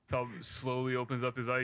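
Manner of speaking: ordinary delivery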